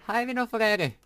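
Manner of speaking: high, loud taunt at the dragon; taunting yell. Real, not acted. Gamer taunting the dragon to come closer, yelling. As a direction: bait the boss, shouting.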